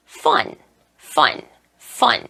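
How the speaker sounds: "fun" with a scraped, lip-on-teeth F. Shown as a demonstration. Teacher re-demonstrates F slowly, "fun" with a scraped F. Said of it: "긁듯이 발음".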